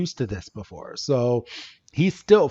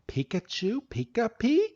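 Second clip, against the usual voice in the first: high, soft questioning creature talk; Coco; 8 semitones higher, 6 dB quieter than usual. Retold or imitated. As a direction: echo creature chatter as a tentative question. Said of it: "freaking Coco is just looking at him".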